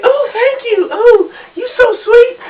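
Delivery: high, breathy mock girl thank-you; hammed-up flattered woman voice. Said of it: "And she'll be like".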